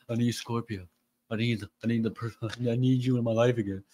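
lightly voiced needy ex, rushed; quick, hurried mock begging; mock desperation. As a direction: imitate someone pleading on the run. Voices person on hamster wheel.

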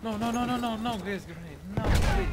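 frantic no no no; grenade warning yell. Real, not acted. Gas grenade incoming.

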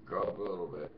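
usual speaking voice

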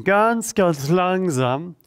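very slow, stretched ganz langsam. Shown as a demonstration. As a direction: draw ganz langsam out slowly. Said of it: "slowing the movement". (ganz langsam gedehnt)

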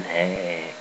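a low, soft "Hey" dropped down deep and quiet; low quiet "Hey" set against a squeaky "Hi". Shown as a demonstration. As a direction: say "Hey" low and quiet, the wrong kind of high. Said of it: "Not that high as in".

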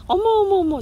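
high, loud startled oh my; surprised squeal. Real, not acted. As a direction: gasp at the cat's antics. Surprised at cat. (고양이를 보고 놀라 높고 크게 외치는 감탄)

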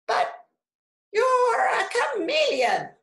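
very high, loud squawk; piercing storybook parrot voice. A narrator's reading. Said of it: "squawked Parrot".

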